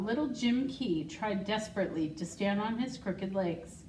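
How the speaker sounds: plain reading voice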